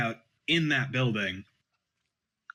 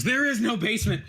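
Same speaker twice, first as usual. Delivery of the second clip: high-pitched cry on waking with a start; sudden, higher outburst from a dream; startled yell; 8 semitones higher than usual. Acted. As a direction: blurt it out, jolted awake. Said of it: "wakes up in bed with a start and goes".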